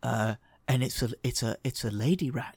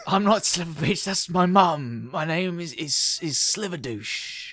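higher, slow, offended snake; indignant snake; 4 semitones higher, 3 dB louder, slower than usual. Acted. GM voicing the snake correcting the insulting gnome. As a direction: correct them with wounded pride.

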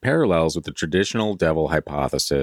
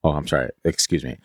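normal talking voice

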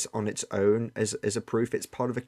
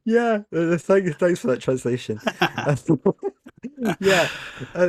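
laughing; 9 semitones higher, 6 dB louder, slower than usual. Real, not acted. Amused by the cheeky translation.

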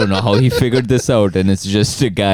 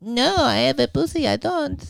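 high, soft mock reply; imitating European girl; 5 dB quieter than usual. To the professor, a high soft put-on answer.

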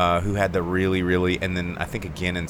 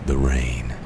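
mock soothing host; 7 semitones lower than usual. Jokes podcast is ASMR now.

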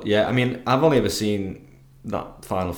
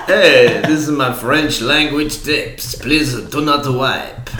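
put-on French accent; 3 semitones higher, 5 dB louder, slower than usual. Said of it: "in a French accent".

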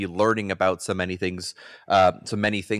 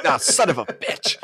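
laughing curse, pitched way up; exasperated, half laughing; 10 semitones higher than usual. Retold or imitated. Toddler wandered off, laughing.

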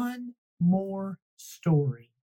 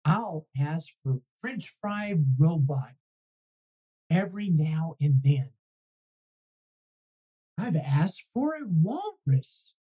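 ordinary delivery

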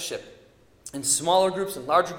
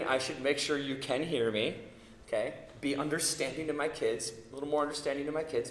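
soft aside; 5 semitones lower, 9 dB quieter than usual. Real, not acted. Concedes softly, aside.

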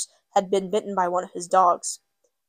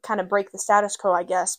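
ordinary delivery

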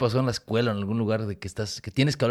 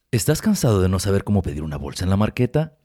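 mock ad read; 3 semitones lower, 4 dB louder than usual. Mock radio ad.

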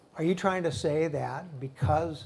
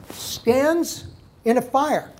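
incredulous; 9 semitones higher, 10 dB louder, much slower than usual. Real, not acted. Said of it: "mocking the governor".

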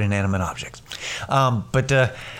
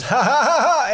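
high-pitched mock belly laugh; imitated laughter; 13 semitones higher, 4 dB louder than usual. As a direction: imitate someone bursting out laughing. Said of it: "he just starts laughing".

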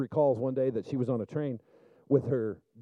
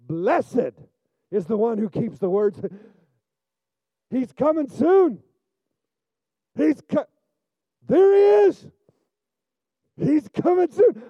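higher and louder, soaring sermon voice; preaching at full force; 11 semitones higher, 7 dB louder, slower than usual. Acted. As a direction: preach it loud and fervent to the congregation.

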